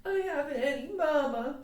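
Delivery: put-on high childish lament; plaintive child proclaiming her woe; lifted-up wail. A narrator's reading. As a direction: wail it like a motherless little girl. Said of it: "she preferred to lift up her voice".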